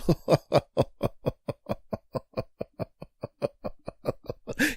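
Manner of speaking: long villain laugh that runs on too long; Joker cackle. Acted. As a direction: keep cackling maniacally. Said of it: "he was laughing like the damn Joker".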